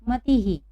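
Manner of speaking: "मती ही" as one word, visarga voiced as ही; visarga demonstration. Shown as a demonstration. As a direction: echo the ई after ह in "मती ही". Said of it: "हम इसको पढ़ेंगे".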